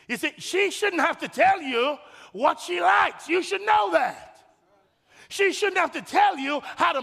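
full-force sermon emphasis; preaching shout. Real, not acted. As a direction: drive it home to the men. Preacher exhorting husbands.

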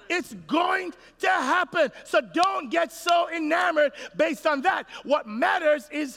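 high slow shouted warning; preached shout. Real, not acted. Preacher warning the men, slow high shout.

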